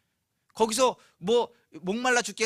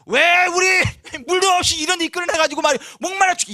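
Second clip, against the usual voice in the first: high, fast, shouted accusation; resentful complaint; 10 semitones higher, 11 dB louder than usual. Shown as a demonstration. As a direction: yell it as a furious reproach. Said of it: "막 원망하는 투로".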